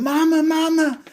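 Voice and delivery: put-on high, loud wail of a frightened child; childlike screaming. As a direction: scream for mama like a guilty child. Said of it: "he's screaming".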